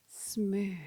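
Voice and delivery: whispered smooth; whispered cue. Real, not acted. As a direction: breathe the word out. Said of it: "whispered cue".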